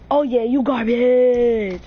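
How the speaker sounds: loud, drawn-out trash talk; taunting yell. Acted. Gamer yelling at his opponent, loud taunt. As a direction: yell it slow at the loser.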